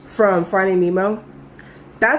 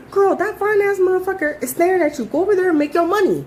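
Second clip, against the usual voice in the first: put-on hyped girlfriend push; 9 semitones higher than usual. Urging coworker toward customer.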